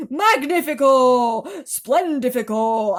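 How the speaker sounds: slow, loud, delighted; gleeful loud cries. A narrator's reading. Greedy aunt cheers the peach, loud and gleeful. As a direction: savour each word as a cheer.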